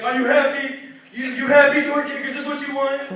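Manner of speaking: high, loud accusing questions; hammed-up unhinged confrontation; having a breakdown. Husband confronts the imagined door kicker, hysterically loud. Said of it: "He starts having a breakdown".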